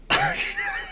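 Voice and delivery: squeaky burst of laughter; real amused giggle; high giggle. Real, not acted. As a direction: giggle in delight. Laughs at card text.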